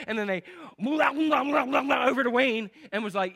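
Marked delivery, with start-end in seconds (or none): 0.8-2.1 loud, higher cartoon gibberish spin; Tasmanian Devil whirl. Preacher imitating an over-eager church newcomer, Tasmanian Devil babble. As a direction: garble it like a whirling cartoon beast. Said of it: "Anybody seen Tasmanian Devil?".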